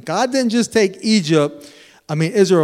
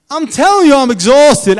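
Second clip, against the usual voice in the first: laughing protest; 8 semitones higher, 9 dB louder than usual. Retold or imitated. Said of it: "I'm like".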